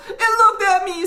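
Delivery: very high, loud horrified cry; panicked sputter. A narrator's reading. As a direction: blurt it out in shock. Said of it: "sputtered the boy".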